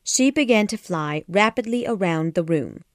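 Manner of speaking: usual speaking voice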